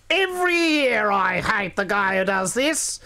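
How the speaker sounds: put-on high, slow, broad Australian old man; broad Australian accent, hammed up and high. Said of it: "slapped me on the back and went".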